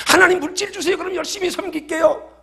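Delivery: very high, eager supplicant voice; mock pleading. Believer asking God for wealth, mock pleading. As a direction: bargain in a hammed-up high plea. Acted bargaining prayer to God.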